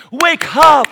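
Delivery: loud, high warning yell; Jesus bellowing a wake-up call; urgent shout. Acted. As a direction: shout it like sounding an alarm. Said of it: "and he goes".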